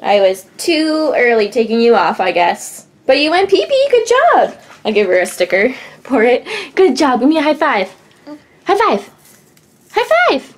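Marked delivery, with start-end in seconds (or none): 3.1-4.6 excited praise. Real, not acted. Praising toddler for potty. 7.2-10.5 rising higher with each ask. Real, not acted. Asking toddler for high five.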